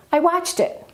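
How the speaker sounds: "watched it" run together, "watched" pitched high; linked, stress on watched. Shown as a demonstration. Teacher showing linking, high stress on "watched" joined to "it". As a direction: link "watched" into "it" with a high beat on "watched". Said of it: "we link it".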